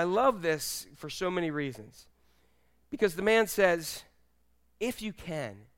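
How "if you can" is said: soft, hushed desperation, falling away; hushed begging; desperate plea. Acted. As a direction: plead quietly, as a desperate father. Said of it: "You hear the desperation in his voice?".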